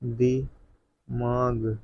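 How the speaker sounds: दिमाग stretched very slowly, ending in a clear g; slow dictation of दिमाग; dictation drawl. Shown as a demonstration. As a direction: drag out दिमाग for the students. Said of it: "दिमाग में कहाँ पर".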